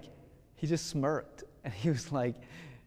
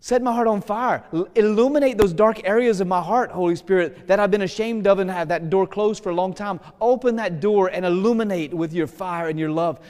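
higher, louder prayer of invitation; fervent prayer; 4 semitones higher, 4 dB louder than usual. Shown as a demonstration. Preacher leading the people in prayer, fervent invitation. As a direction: beg the Spirit to come in and set the heart on fire. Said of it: "Invite him in to your heart".

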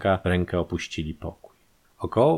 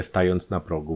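plain reading voice

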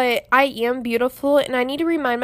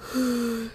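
yawn; 6 dB quieter than usual. Real, not acted. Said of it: "I yawned".

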